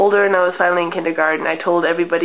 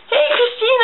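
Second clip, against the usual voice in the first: much higher call across the yard. Retold or imitated. Child calling to her friend from the window, high call. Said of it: "she's like".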